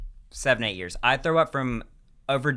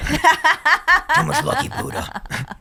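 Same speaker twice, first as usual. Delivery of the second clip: much higher, very slow put-on voice; hammed-up silly voice; 6 dB louder, much slower than usual. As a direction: stretch it out in a high goofy voice. Acted self in sixties.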